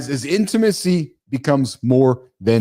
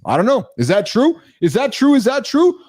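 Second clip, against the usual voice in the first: rising higher with each question; incredulous; 7 semitones higher than usual. Real, not acted. Host questioning his notes: rising disbelief. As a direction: question it more and more.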